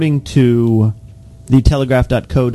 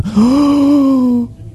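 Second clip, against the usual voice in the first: shocked gasp; 13 semitones higher than usual. Pretend. Mock shock after teasing.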